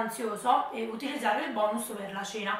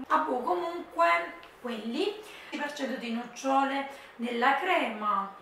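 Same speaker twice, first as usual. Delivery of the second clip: usual speaking voice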